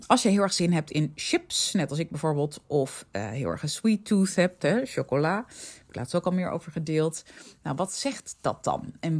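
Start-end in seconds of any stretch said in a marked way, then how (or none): none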